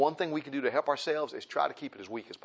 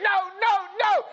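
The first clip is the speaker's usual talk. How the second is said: much higher, loud whiny refusal; stubborn flesh refusing; 6 dB louder, slower than usual. Acted. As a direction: play the flesh digging its heels in.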